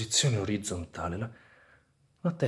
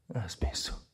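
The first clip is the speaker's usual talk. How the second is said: relieved whisper; 5 semitones lower, 8 dB quieter than usual. Acted. Relief, pain stopped.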